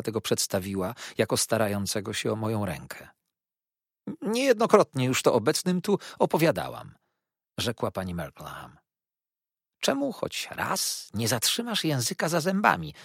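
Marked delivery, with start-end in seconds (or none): 4.2-6.9 high, louder put-upon protest; self-important interjection. A narrator's reading. Mother reminds the company of her telling, self-important. Mother cuts in on daughter's story. 9.8-12.9 high-pitched, irritated aside; exasperated grumble. A narrator's reading. Aunt grumbles at talkative mother, exasperated. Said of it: "mrugnęła moja ciotka".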